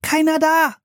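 hoch und hell von oben herabrufen; nach unten gerufen. A narrator's reading. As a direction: Entwarnung nach unten rufen. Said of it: "rief Anne nach unten". (Anne ruft Philipp vom Baumhaus herab zu)

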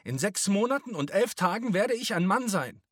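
high, loud outburst; boy's defiant shout. A narrator's reading. Josef struggling in the men's arms, shouting defiantly. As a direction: scream it defiantly at the men. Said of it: "schrie er sie an".

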